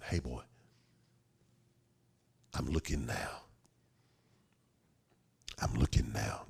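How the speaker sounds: low, hushed fatherly voice; tender reassurance. Acted. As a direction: reassure your boy, very softly and low. Father tenderly reassures his son.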